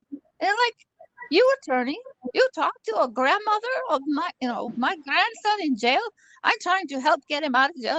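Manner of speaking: granny pretending to wire bail money. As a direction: scold him like a flustered granny. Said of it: "I finally come to my regular voice".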